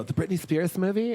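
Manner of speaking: high-pitched mock question; clueless reply. Friends mistaking which Crossroads is meant, mock clueless. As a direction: imitate someone innocently getting it wrong.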